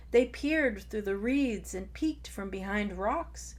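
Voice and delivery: ordinary delivery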